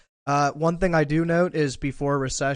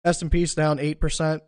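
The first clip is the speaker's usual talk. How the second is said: ordinary delivery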